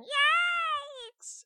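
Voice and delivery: very high, loud shriek; squirrel yelp. Acted. Francesca fleeing the dragon, a very high frightened yelp.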